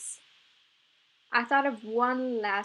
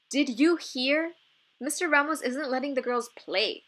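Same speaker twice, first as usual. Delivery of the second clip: exasperated; 5 semitones higher, 5 dB louder than usual. A narrator's reading. Violeta complaining to Itza about the coach, exasperated. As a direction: vent your frustration at the unfair rule. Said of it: "said with exasperation".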